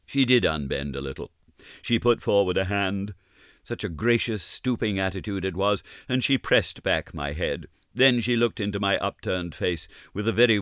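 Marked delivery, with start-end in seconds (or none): none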